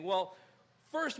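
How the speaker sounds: ordinary delivery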